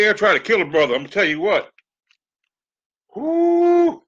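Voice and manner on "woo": very high loud woo; excited whoop. Real, not acted. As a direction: whoop from the burn. Hot drink kicks in.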